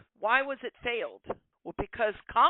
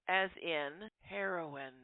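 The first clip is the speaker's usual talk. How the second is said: ordinary talk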